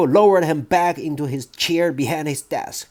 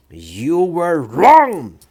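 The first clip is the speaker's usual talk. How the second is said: slow, louder bark; 3 semitones higher, 6 dB louder, much slower than usual. Acted. Trunchbull barks at nervous boy, slow loud bark. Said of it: "the Trunchbull barked".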